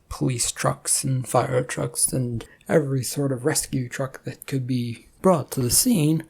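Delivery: ordinary delivery, nothing marked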